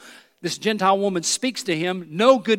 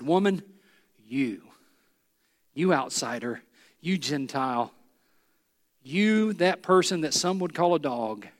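much lower and softer, gentle and lingering; tender affirmation; 5 semitones lower, 3 dB quieter than usual. Acted. Jesus looking at the Gentile woman, tender and quiet. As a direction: speak to her as someone you cherish.

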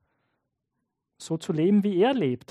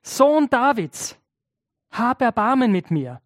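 raised pleading call, pitch higher; loud cry; 4 semitones higher than usual. Retold or imitated. Blind beggar calling to Jesus for mercy, loud plea. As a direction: call out for mercy over the crowd. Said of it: "er ruft ganz laut".

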